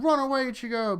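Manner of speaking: high, loud retold shout; urgent warning. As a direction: call out a mock warning to flee.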